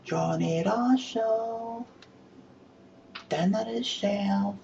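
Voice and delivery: high, very slow sing-song call; woman calling her man in for dinner; woman calling out the window. Shown as a demonstration. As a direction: call him in for dinner, drawn out. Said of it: "Shouting out the window".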